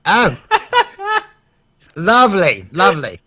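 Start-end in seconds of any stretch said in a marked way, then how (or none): 0.4-1.2 high-pitched laugh; squeaky burst of laughter; high giggle. Real, not acted. Laughs at the phone number joke.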